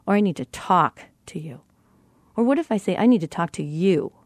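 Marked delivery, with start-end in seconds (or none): 0.1-1.6 "talk" stretched and set apart; stress on TALK. Shown as a demonstration. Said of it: "feel the difference in that inflection". 3.0-4.1 final "you" leaned on and lengthened; stress on YOU. Shown as a demonstration. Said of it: "feel the difference in that inflection".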